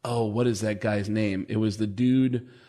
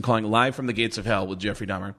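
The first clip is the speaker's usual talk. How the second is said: usual speaking voice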